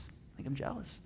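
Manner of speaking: "I think I'm jealous" whispered, barely audible; hushed whisper of "I think I'm jealous". Shown as a demonstration. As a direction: breathe it out like a secret confession. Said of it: "repeats the admission in a whisper".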